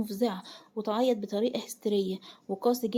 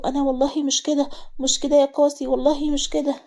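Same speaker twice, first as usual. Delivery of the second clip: higher, pleading repetition; fearful, tense; 4 semitones higher, 6 dB louder than usual. A narrator's reading. Wife repeats her innocence under blows, fearful and tense. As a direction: keep protesting anxiously in a raised pitch. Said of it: "من كتر الخوف والتوتر".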